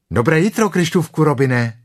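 high, loud greeting; calling out. A narrator's reading.

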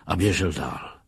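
ordinary delivery

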